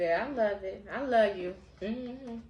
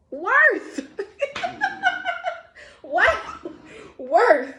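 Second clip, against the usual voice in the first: laughing; 12 semitones higher, 8 dB louder, much slower than usual. Real, not acted. Laughing over the card's word.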